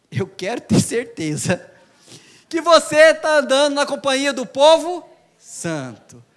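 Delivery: ordinary delivery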